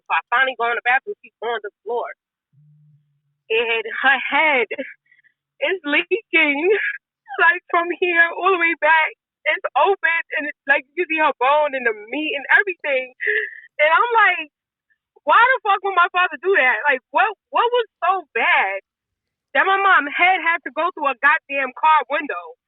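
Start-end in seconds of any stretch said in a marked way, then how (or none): 1.2-13.1 tearful, high-pitched voice; crying. Real, not acted. Tearfully describing her mother's injury. 13.8-22.6 high, tearful disbelief; distraught. Real, not acted. Emotional, distraught retelling of the attack.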